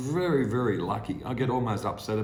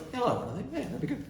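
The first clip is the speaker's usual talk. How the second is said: mock falsetto reply; 13 semitones higher, 3 dB quieter than usual. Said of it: "That was horrible".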